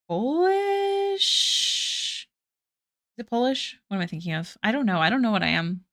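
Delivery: usual speaking voice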